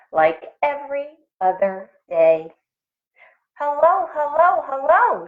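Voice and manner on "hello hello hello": high, bright call of hello; cheerful shouted hellos; shouted greeting. A narrator's reading. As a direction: call out hello across the meadow. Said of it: "shouted Jitterbug".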